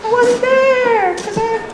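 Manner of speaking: high, hammed-up schoolgirl despair; mock anguished wail. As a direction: wail in mock horror at missing out. Girl learns she missed the boy.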